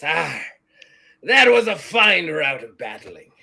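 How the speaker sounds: loud pirate voice, big start and falling end; hearty pirate. Acted. Pirate walks over to praise the fighters, hearty. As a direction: praise it like an old sea dog.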